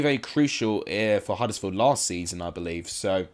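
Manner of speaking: ordinary delivery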